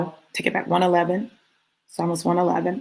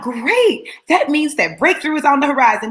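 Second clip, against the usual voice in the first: bright, much higher mock-cheery voice; mock upbeat friend; 8 semitones higher, 3 dB louder than usual. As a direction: parrot their cheerful pep talk. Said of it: "They were like".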